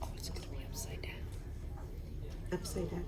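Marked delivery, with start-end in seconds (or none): 0.0-1.1 fast whispered aside; whispering. Real, not acted. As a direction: whisper it quickly to yourself. Realizes model is upside down.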